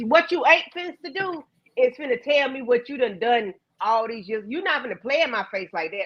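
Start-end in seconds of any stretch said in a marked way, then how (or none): none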